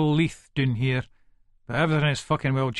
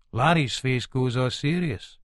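plain reading voice

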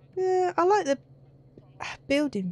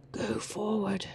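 whispering; 3 semitones lower, 7 dB quieter than usual. Real, not acted.

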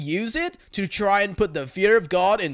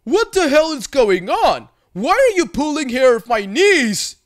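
put-on loud, higher, booming Russian accent; thick Russian accent; 6 semitones higher, 7 dB louder than usual. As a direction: loom over her in a heavy Russian accent. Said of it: "speaking in a thick, heavy Russian accent".

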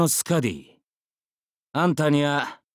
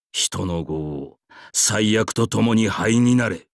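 slow incantation; 6 semitones lower, slower than usual. Acted.

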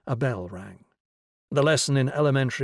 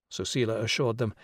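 ordinary delivery, nothing marked

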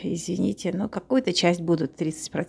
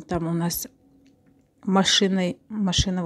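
usual speaking voice

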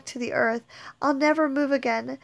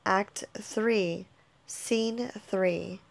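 ordinary delivery

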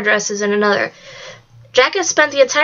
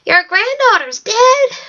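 put-on high, slow, shocked old woman; aghast old woman; 10 semitones higher, slower than usual. A narrator's reading. Old woman thinks Red is dead, aghast, high and slow. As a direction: gasp it out in high disbelief. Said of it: "the old woman said aghast".

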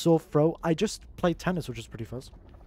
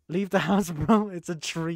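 usual speaking voice

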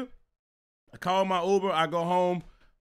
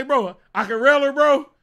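raised, loud, tickled echo; amused disbelief; 5 semitones higher, 8 dB louder than usual. Retold or imitated. Echoing the guy's tip back, amused disbelief. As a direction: repeat it louder, barely believing it. Repeats the tip while cracking up.

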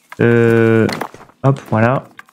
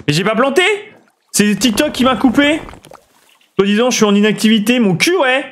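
much higher, heated denial; indignant protest; 8 semitones higher than usual. Real, not acted. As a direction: fight back the accusation, annoyed. S'énerve encore contre TikTok. (protestation indignée, voix beaucoup plus aiguë)

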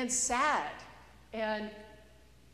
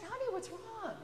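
father's gentle question; 11 semitones higher, 4 dB quieter than usual. Acted. Father gently asks crying daughter.

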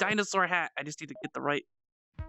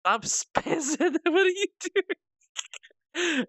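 high, slow words squeezed through laughter; real helpless laughing disbelief; cracking up; 11 semitones higher, much slower than usual. Real, not acted. Streamer to the spazzing avatar, cracking up.

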